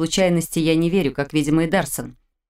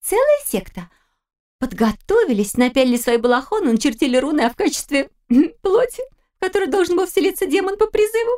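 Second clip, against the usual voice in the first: put-on much higher, giggly teenage girl; girlish voice; 10 semitones higher than usual. A narrator's reading. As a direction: tease it like a mischievous daughter. Daughter tells the story.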